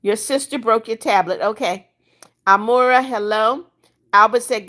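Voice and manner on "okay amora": ordinary talk, nothing marked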